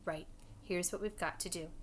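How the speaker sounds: usual speaking voice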